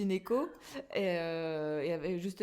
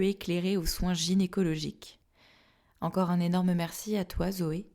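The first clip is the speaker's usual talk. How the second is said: louder, steady scripted outro; formal read statement; 7 dB louder than usual. Acted. Closing statement read to listeners.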